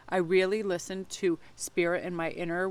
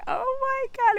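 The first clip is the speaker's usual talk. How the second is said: squealed high in amused disbelief; a giddy, shrieking oh my God; mock shocked squeal at her own dream; laughing disbelief; 6 dB louder than usual. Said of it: "and I was like".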